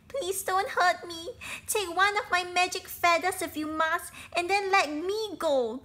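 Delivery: high, loud pleading bird voice; pleading bird cry. Acted. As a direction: beg for your life as a small bird. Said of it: "cried the lucky bird".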